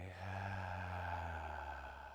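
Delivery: long, soft, audible sigh out; big sigh. Shown as a demonstration. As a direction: let out a long releasing breath. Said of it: "Big sigh".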